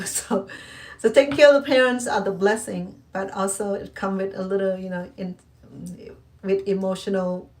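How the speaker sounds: ordinary talk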